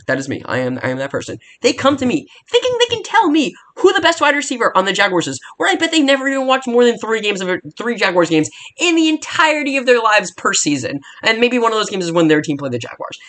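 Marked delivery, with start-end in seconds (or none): 1.6-13.2 furious fan venting at Twitter critics; heated rant. Real, not acted. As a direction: let the frustration boil over at the know-it-alls. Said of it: "I'm getting a little heated".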